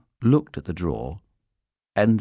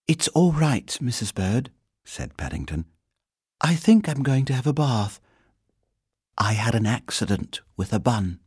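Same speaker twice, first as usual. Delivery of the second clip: higher-pitched, earnest bear voice; polite little bear; 6 semitones higher than usual. A narrator's reading. Bear reassuring the housekeeper about his bath, polite. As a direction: explain politely as a small bear.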